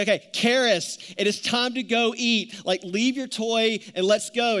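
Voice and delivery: mock-stern father laying down the law; stern dad call. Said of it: "And so I say".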